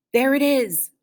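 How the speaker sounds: usual speaking voice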